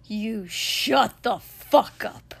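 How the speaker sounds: slow, loud, hard-hit yell; enraged shout; furious bellow. A narrator's reading. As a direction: bellow it word by word. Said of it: "Katsuki bellowed".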